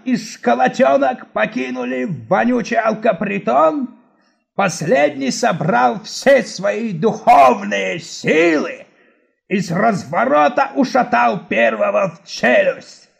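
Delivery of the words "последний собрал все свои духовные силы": usual speaking voice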